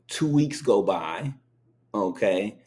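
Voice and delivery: ordinary talk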